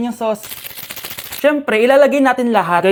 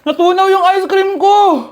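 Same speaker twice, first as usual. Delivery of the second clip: dismayed exclamation; 6 semitones higher, 7 dB louder than usual. Acted. Dismay at melted ice cream.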